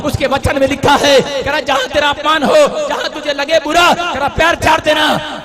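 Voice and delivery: loud, rising sermon proclamation; fiery pulpit declaration; full-force preaching. Acted. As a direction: preach it out to the whole hall. Preacher quoting defiance, building loud.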